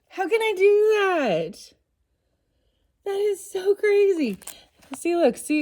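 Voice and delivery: high, loud, thrilled disbelief; amazed excitement. Real, not acted. To her viewers about the book, amazed and thrilled. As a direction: squeal at the magic book's pick.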